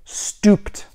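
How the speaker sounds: "stopped" as one syllable, stooped; British-style STOPPED. Shown as a demonstration. Correcting the demo, "stopped" as stooped. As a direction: say "stopped" with OO and no second vowel. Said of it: "not stupid, stooped".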